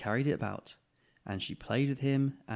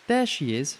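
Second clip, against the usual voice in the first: louder, higher glad shout; 5 semitones higher, 6 dB louder than usual. Acted. Boy spotting the cat in the garden, shouting. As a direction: call out on spotting her. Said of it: "shouted Nicky".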